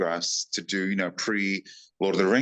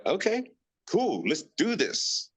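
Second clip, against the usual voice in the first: high and slow, savoring it; mock delight, pitch well up and unhurried; eager yes; 11 semitones higher, slower than usual. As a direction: draw it out with glee. Eager acceptance of deal.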